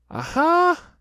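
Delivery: high, loud squeaky aha; a little pig trying to sound bold; Piglet's high aha; Piglet's triumphant aha. A narrator's reading. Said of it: "said Piglet".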